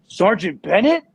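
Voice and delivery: loud, high-pitched startled question; imitating a startled recruit; surprised recognition. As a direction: call it out high in disbelief. Recruit recognizes him mid-run.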